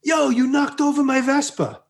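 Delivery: put-on high-pitched kid calling out; young street kid. Kid to the woman who knocked it over, mock accusation. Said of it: "a young kid here on the street that said".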